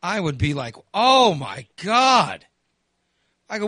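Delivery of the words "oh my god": very slow, loud shocked groan; shocked exclamation. Retold or imitated. Son imagining the stench, slow loud shock. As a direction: drag it out in disbelief.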